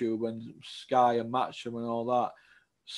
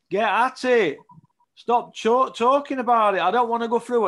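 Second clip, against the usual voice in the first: louder and higher, fed up with the waiting; exasperated outburst; 7 semitones higher, 6 dB louder than usual. Real, not acted. Fed up with promoters stalling, a frustrated shout. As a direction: bark it out like you've had enough.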